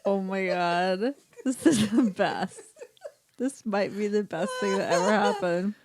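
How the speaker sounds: talking through helpless laughter; real laughter nearly choking the words; laughing. Real, not acted. Helpless laughing at friend's accent.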